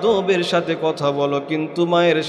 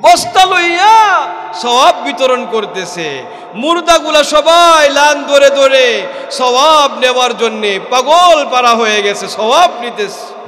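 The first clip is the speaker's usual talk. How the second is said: loud, high impassioned shouting; preaching shout; 8 semitones higher, 7 dB louder than usual. Acted. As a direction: shout it out with fervour. The line repeated, then shouted.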